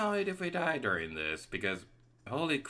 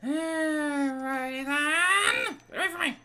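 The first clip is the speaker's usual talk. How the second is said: much higher, slow playful voice; high silly voice; 4 dB louder, slower than usual. Pretend. Gamer narrating play in a squeaky voice. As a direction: squeak it out in a cartoon voice.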